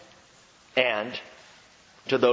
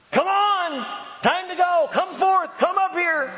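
joyful shout; 14 semitones higher than usual. Acted. Jesus calling the church up to heaven, shouting. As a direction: call out like waking the dead. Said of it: "That's the shout".

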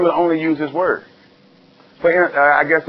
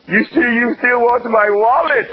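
mock taunt; 5 semitones higher, slower than usual. Taunting mugger with gun.

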